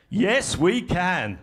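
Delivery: "Yes, we can" loud and slow, three heavy beats; strong political beats. Shown as a demonstration. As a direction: hammer out "Yes, we can" like a rally slogan. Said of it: "They're kind of strong beats".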